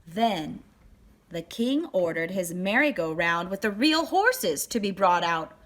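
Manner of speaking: ordinary delivery, nothing marked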